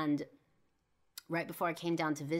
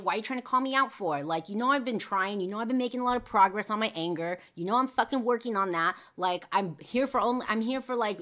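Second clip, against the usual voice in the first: high, loud, indignant protest; acted angry outburst, words tumbling on; heated arguing; 6 semitones higher, 7 dB louder than usual. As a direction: fight back against an accusation. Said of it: "got into a fucking huge argument".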